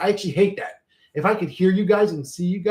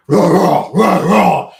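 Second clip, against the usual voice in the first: loud growling game-monster rah; Altered Beast roar; 11 dB louder, much slower than usual. Retold or imitated. Recalling an old arcade game, loud monster roar. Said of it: "do you remember Altered Beast".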